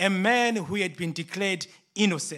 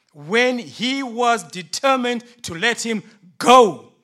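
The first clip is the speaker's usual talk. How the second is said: preaching the scripture; 8 dB louder than usual. Acted. Reads scripture with force.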